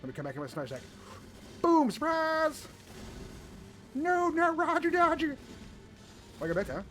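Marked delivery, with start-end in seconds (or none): 1.6-5.4 mock dramatic wail. Mock dramatic lament in game.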